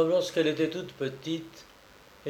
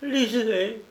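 higher, breaking single word; exhausted, voice cracking; broken voice; 8 semitones higher than usual. Acted. Daughter at the end of her strength handing over the letter, broken voice. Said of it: "elle dit d'une voix brisée".

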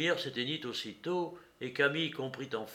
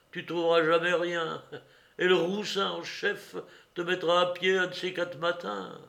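raised, needling, contemptuous voice; scornful woman; 5 semitones higher, 5 dB louder than usual. A narrator's reading. Woman mocks old comrade as police spy, scornful. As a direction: jeer at the would-be policeman.

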